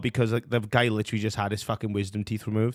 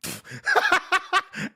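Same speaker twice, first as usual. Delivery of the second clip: sudden squealing laugh, much higher; high burst of laughter. Real, not acted. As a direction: crack up at the insult. Reacting to the clip.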